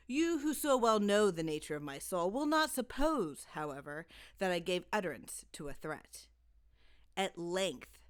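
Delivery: ordinary delivery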